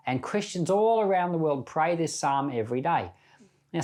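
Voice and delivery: usual speaking voice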